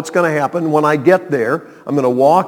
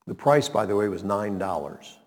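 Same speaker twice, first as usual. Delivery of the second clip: ordinary delivery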